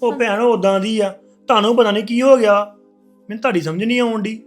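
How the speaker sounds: heated protest. Acted. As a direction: push back hard at her. Husband argues with sister.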